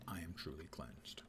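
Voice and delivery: low, soft afterthought; quiet aside. Pretend. Player repeats the news to unaware viewers, quiet aside. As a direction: drop it low and under your voice.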